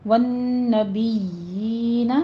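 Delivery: والنبیین chanted slowly with humming nn; very drawn-out recitation of والنبیین; ghunna and madd. Shown as a demonstration. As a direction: hold the nn, then the long yee in والنبیین. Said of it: "یہاں غنہ واجبہ ہے".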